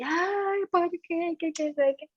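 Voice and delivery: alarido lastimero, ligeramente imitado, más agudo; lamento fingido de la gente. Retold or imitated. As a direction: imita a los dolientes quejándose. Gente llorando y dando alaridos. (la gente llora a gritos, lamento imitado a medias)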